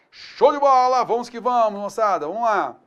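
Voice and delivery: loud, upbeat rallying call; energetic teacher hype; excited cheer. Real, not acted. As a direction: fire up the class.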